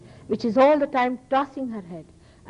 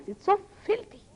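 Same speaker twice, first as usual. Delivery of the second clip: disgusted; 11 semitones higher than usual. Real, not acted. Disgust at talk of sex.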